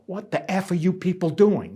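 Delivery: imitating a furious striker, half-hearted; angry swearing nurse. A nurse grabbing the union rep and swearing, mock yell. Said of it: "they'd swear at me".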